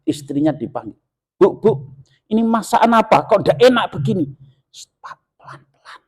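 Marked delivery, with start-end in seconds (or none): 1.3-4.3 much higher, louder shout at his wife; angry complaint. Retold or imitated. Father yelling at his wife about the bad soup, mock angry. 5.0-6.0 urgent whisper; hushing whisper. Retold or imitated. Wife whispering to her husband to calm down.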